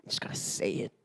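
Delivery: whispered, steeling himself; nervous whisper. Retold or imitated. Nervous guy whispering to himself before calling her. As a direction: breathe it out under his breath.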